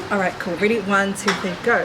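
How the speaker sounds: ordinary talk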